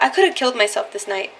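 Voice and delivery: normal talking voice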